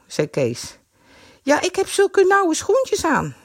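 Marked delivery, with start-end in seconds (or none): 1.4-3.4 put-on high, slow complaining girl; girlish whine. A narrator's reading. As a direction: stretch the excuse out.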